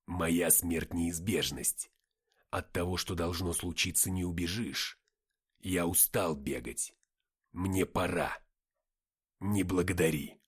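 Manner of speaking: low, slow, weighty voice; heavy, low-pitched resignation; resigned farewell. A narrator's reading. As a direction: deliver last words slowly and deliberately. Dying fighter's farewell speech.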